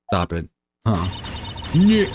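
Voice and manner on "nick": loud, high call of the name; louder call. Shown as a demonstration. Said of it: "not saying it loud enough".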